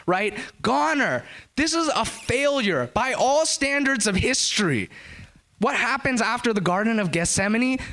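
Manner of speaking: high, driven preacher's voice; fervent sermon delivery, pitched high; full-force preaching. Acted. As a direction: preach it, rising to the cross. Preacher builds to Jesus' crucifixion.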